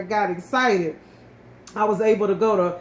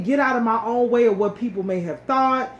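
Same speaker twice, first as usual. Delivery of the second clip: ordinary talk, nothing marked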